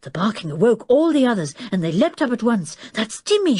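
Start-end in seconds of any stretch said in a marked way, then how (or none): 2.9-3.6 high, urgent call; shouting. A narrator's reading. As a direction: call out in alarm, pitched high. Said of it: "shouted Julian".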